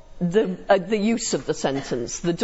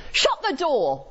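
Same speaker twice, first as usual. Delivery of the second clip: imperative tone; 5 semitones higher than usual. Shown as a demonstration. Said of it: "imperative tone".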